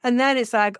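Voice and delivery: ordinary talk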